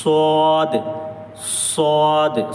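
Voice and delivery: صاد said heavy and full; thick sad. Shown as a demonstration. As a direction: let صاد sound thick. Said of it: "صاد کی آواز موٹی ہوتی ہے".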